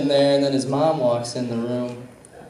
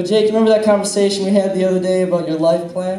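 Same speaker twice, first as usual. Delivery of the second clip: teasing; 6 semitones higher, 5 dB louder, faster than usual. Retold or imitated. Teasing the kid next day.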